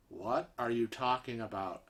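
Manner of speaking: very hushed, low bewildered reply; stunned quiet. Retold or imitated. Husband answering screaming stranger in restroom, hushed bewilderment. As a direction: barely voice it, as if dumbfounded.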